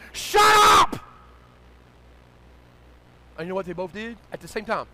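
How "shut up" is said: very high, very loud scream; full yell. Retold or imitated. Child to arguing adults, full-throated yell. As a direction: explode over the bickering. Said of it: "And I yelled".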